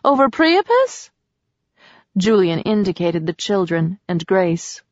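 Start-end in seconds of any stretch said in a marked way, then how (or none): 0.0-1.1 you can't be serious, over him?; shocked disbelief that anyone would feel guilty; incredulous. A narrator's reading. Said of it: "Selena asked in disbelief".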